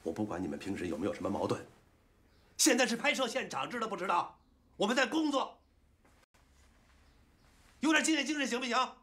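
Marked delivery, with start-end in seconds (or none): 2.6-8.9 high, loud, furious reprimand; angry scolding shout. Acted. Director scolding quarrelling actors on set, shouting angrily. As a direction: yell at them to get back in line.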